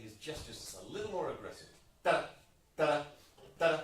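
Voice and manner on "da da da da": da sung with a hard hit on the first note of each group; accent on first note. Shown as a demonstration. Conductor asking for more aggressive gestures, accented sung rhythm. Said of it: "with an accent on the first note".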